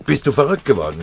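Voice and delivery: laut und höher, empört; brüll ihn empört an; schreiend. Acted. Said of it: "schrie er". (lauter, empörter Ausruf)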